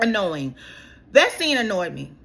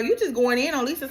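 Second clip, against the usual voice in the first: ordinary delivery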